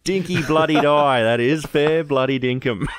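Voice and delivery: mock broad Aussie. Joking with mates about how Aussie the title sounds, broad Australian. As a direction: lay on the ocker Aussie thick. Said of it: "how bloody Aussie does that sound".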